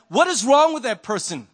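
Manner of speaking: exasperated outburst at another driver; annoyed. Retold or imitated. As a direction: snap it out, irritated. Frustrated driver in traffic.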